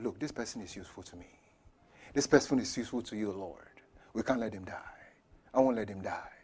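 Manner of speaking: very soft, low, half-whispered plea; hushed pleading prayer. Retold or imitated. Pastor telling the Lord this person is useful, hushed prayer. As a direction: plead almost in a whisper to God.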